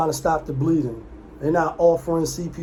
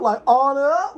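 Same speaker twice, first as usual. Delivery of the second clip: hyped repeat of the title; 8 semitones higher than usual. Real, not acted. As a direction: punch it harder the second time. Repeating the movie title, louder.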